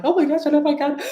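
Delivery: mock panic, pitched high; freaking out. Imagined viewer doubting they can handle it, mock freak-out. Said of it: "your human's freaking out".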